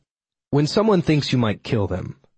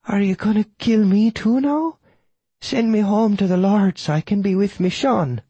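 high-pitched, quavering widow voice; frightened old Irish widow; 9 semitones higher than usual. Acted. Widow fears the killer will take her too, frightened high voice.

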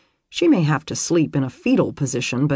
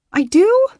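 high, loud squeaked question; 8 dB louder than usual. A narrator's reading. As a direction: squeak it out in alarm. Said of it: "she squeaked".

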